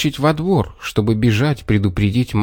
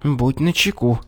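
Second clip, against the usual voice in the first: higher, hushed order; commanding fox; 4 semitones higher, 3 dB quieter than usual. A narrator's reading. Fox readies the bear at the door, hushed order. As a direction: warn him with a mild command. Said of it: "приказала лиса".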